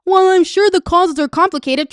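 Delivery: lightly voiced imitation of a cautious politician; mocking JD Vance. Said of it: "Trump doesn't speak like this".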